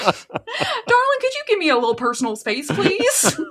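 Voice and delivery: a Southern belle shooing off a pest; high sweet Dolly voice. Pretend. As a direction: lift it way up, sugary and polite. Voicing Dolly, laughing.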